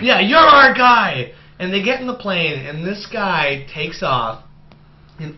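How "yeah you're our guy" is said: drawn-out mock hero declaration; slow emphatic hero line. Hammed-up Cusack telling the pilot he's their guy, slow and emphatic. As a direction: stretch it out like a cheesy action hero. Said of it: "and he's like".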